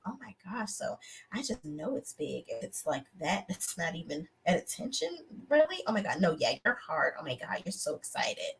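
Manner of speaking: hushed flirty whisper throughout. Retold or imitated. Reviewer mimics the woman flattering him in a soft whisper. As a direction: breathe the flattery almost silently.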